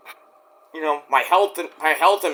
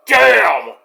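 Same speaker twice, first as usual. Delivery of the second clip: loud, high shout of amazement; impressed exclamation; 6 semitones higher, 6 dB louder than usual. Real, not acted. Vlogger stunned by TV ratings, loud shout. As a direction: blurt it out, floored.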